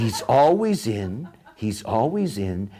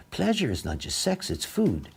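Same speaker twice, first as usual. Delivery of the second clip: normal talking voice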